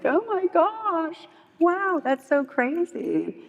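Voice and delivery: high, drawn-out gush of amazement; mock amazement. Reacting to a friend's story of last night, amazed.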